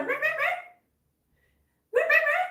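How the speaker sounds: very high mock cat cry; imitating cat yowl. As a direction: squeak out the cat's little yowl. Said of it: "running around the house going".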